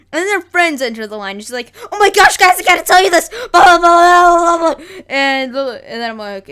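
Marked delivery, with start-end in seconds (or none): 1.9-3.3 loud, high gossip blurt; hammed-up girl bursting with news; shrill-pitched imitation of excited telling; mock freaking out. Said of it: "freaking out". 3.5-4.8 loud, high babble; hammed-up imitation of noisy gossip; mock chatter. Mimics her excited chatter.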